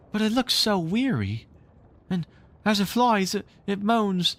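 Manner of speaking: put-on high boy's voice, hushed wonder; awed boy. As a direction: marvel at the tired bird. Nibs describes the weary bird.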